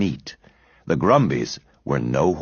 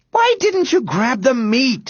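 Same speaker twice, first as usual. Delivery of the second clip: high, drawn-out indignant demand. A narrator's reading. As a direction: demand an answer, winded and cross. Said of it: "he demanded as he paused to catch his breath".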